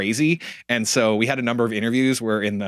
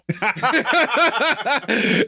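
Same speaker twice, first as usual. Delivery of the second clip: high burst of laughter; bright laugh; 13 semitones higher than usual. Real, not acted. Laughing at CEO mix-up.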